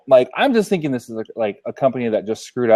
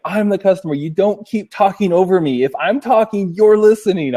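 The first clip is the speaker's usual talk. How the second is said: higher, forceful scolding; retold yelling; 7 semitones higher than usual. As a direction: lay down the law as the customer. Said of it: "I'm yelling on the phone".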